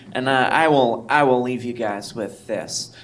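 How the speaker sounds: ordinary delivery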